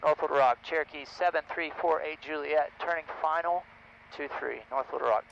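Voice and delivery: loud, clipped pilot radio call. Real, not acted. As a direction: announce position over the radio.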